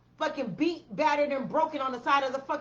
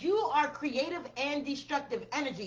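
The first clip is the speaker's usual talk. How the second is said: ordinary talk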